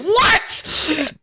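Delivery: very high squawk of disbelief; high, incredulous outburst; shocked bandit. Acted. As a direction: yelp it in hammed-up shock. Smitty shocked at being drafted.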